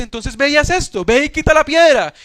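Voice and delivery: más alto y más fuerte, mandato firme; orden de Jesús. Acted. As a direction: manda con autoridad que quiten la piedra. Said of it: "Jesús nos dice". (Jesús ordenando, voz más alta y fuerte)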